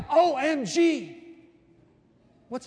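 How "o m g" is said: high, drawn-out mock gasp of amazement; mock astonishment. Texter reacting to a slam dunk, mock-amazed. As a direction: stretch each letter high in exaggerated awe. Diner raving about blueberry pie.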